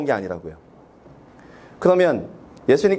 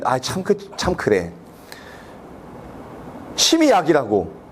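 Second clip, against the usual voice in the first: mother's folk remedy; 3 semitones lower, 3 dB quieter, slower than usual. Retold or imitated. Mother voiced in story.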